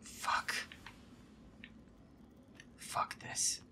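usual speaking voice

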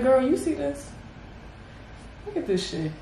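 a hint of a shady aside to a friend, fading to a soft mutter; lightly voiced gossiping side comment; mildly shady whisper to a girlfriend; mock side-eye. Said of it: "gotta look at them like".

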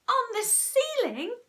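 very high disbelieving question; small monkey can't believe it; astonished cry. A narrator's reading. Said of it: "cried one of the small monkeys".

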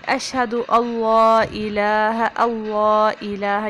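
اشہد اللہ الہ slow, nun not sounded; correct recitation. Shown as a demonstration. Teacher demonstrating the shahada. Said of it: "نون جو ہے وہ پڑھ- نہیں".

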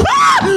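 high frightened shriek; real shriek of fright; panicked scream. Real, not acted. Jump scare after Бу.

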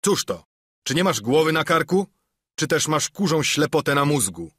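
hammed-up outrage; indignant scolding. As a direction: scold a dim sailor. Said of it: "obruszył się długi John".

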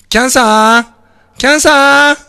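高く大きく、名前の語尾を長く伸ばす; 離れた相方に声を張って呼ぶ; 大声で呼びかけ. Real, not acted. 配信を忘れた相方を呼ぶ. (「キャンサー」と伸ばして叫ぶ)

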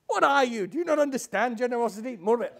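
high, loud, affronted demand; indignant scolding. Acted. Old woman scolds stingy host.

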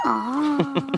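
soft, high, long meow; cat meowing. Acted. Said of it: "And a cat says".

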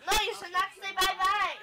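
ordinary delivery

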